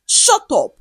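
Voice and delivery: sharp, high angry snap. A narrator's reading. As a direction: cut her off in anger. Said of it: "Odinaka said angrily".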